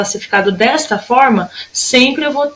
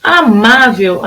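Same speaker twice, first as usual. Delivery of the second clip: "Amável" slowly, leaning on MÁ; stressed syllable shown; much slower than usual. Shown as a demonstration. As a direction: lengthen the MÁ in "Amável". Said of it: "a minha sílaba tônica é o má".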